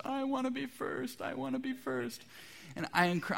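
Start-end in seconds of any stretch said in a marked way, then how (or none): 0.1-2.2 put-on much higher, soft wail of a small child; whiny crying kid. Pastor imitating his crying child on training wheels, high whine. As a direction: whimper it like a kid left behind on a bike. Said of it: "starts crying and says".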